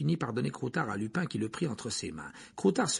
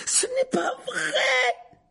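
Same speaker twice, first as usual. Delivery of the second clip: high, slow anguished scream; yelled denial; 5 dB louder, much slower than usual. A narrator's reading. Harry to Lupin, anguished yell. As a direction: scream the denial through tears. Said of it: "hurla Harry".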